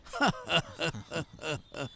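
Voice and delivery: soft gloating villain laugh; evil laugh. Acted. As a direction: sly chuckle of triumph. Villain gloats over deaths. (rire sournois et satisfait)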